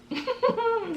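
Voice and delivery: quick high-pitched laugh; a short high scoffing laugh. Retold or imitated. Darren laughs at the warning.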